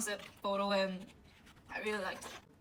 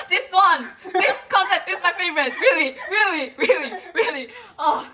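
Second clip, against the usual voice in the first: higher, louder, laughing delight; ecstatic shout; 7 semitones higher, 11 dB louder than usual. Real, not acted. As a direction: shout it out, beside herself with joy. Shouting her favorite concept.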